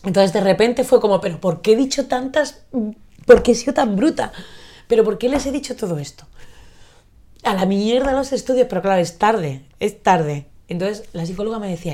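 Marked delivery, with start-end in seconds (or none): 1.2-8.7 higher, louder, berating herself; a raised, frustrated inner outburst; exasperated self-reproach. Retold or imitated. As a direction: replay the moment you realize you were too harsh. Self-reproach quoted, sudden realization.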